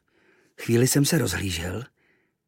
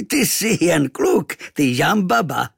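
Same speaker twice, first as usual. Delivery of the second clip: slow, loud, higher amused voice; laughing native; 4 semitones higher, 3 dB louder than usual. Acted. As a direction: chuckle at the boy. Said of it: "rozchechtal se".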